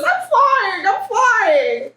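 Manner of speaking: high, gleeful little fish voice; delighted small creature; excited fish. Acted. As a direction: cry it out high, gliding down. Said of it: "and the fish says".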